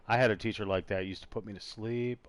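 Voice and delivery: ordinary talk, nothing marked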